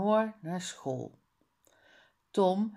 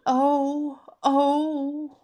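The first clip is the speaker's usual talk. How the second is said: hammed-up higher moaning "o, o"; mock moan; 7 semitones higher, 7 dB louder than usual. Tom moaning to the teacher, louder mock moan. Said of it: "Hij roept".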